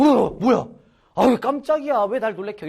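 loud, high startled outburst; startled friend. Retold or imitated. 범우 startled by a tap on the shoulder, loud. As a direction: jump like someone tapped from behind. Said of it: "갑자기 깜짝 놀라고".

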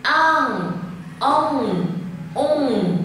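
"Ang, ông, ung" with the final ng ringing in the nose; "ung" closing into a sustained nasal hum; clear nasal endings. Shown as a demonstration. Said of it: "âm mũi rất là rõ".

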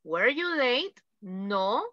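slow, carefully spelled-out example; slow model sentence. Shown as a demonstration. Teacher modelling a past-tense answer for the class, slowly. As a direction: say it slowly so students copy it. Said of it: "I arrived en pasado".

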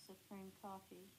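ordinary delivery

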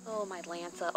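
ordinary delivery

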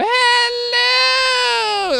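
a shrieking, over-the-top hello; overboard hello. Shown as a demonstration. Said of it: "you walk up to somebody and you go".

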